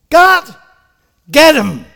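loud "God, get him"; the honest angry prayer, shouted; mock vengeful prayer. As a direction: bark the plea like a grudge. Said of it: "Don't go to him and say".

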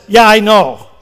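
a loud "Yeah, I know"; God, unimpressed, speaking up; God's loud knowing reply. Retold or imitated. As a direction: answer like God who sees through it. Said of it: "God says".

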